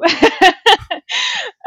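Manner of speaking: high, loud laugh bursting out; real amused laugh; bright burst of laughter. Real, not acted. Laughing at her own story.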